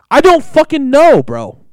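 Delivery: loud, high, drawn-out protest; exasperated outburst. Real, not acted. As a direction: blurt it out at full exasperation. Ranting about ordering by phone.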